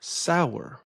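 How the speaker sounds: Sour said as two syllables, stress on sow. Shown as a demonstration. Lecturer shows sour as two syllables, stressed on the first. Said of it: "give a strong stress to the sow part".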